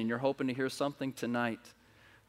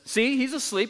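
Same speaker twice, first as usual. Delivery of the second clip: man's eager plea; 10 semitones higher, 3 dB louder than usual. Acted. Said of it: "and the man says".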